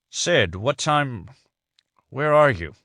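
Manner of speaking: drowsy, slow, just woken; half-awake mumble; sleepy. Acted. Henry woken by Sydney's late call, sleepy and slow. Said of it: "A sleepy voice answered".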